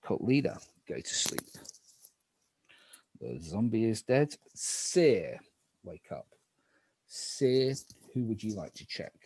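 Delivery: low, hushed night-phase calls; spooky game narrator. Acted.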